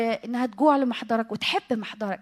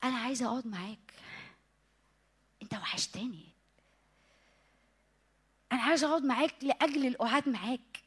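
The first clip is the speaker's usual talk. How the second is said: intimate soft prayer; 9 dB quieter than usual. Real, not acted. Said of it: "ببقى حاسة".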